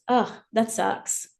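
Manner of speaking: slow, commiserating groan of agreement; sympathetic ugh. Retold or imitated. Parent commiserating with a griping teen, slow and sympathetic. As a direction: drag it out like you truly get it. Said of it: "what they most wanna hear from a parent".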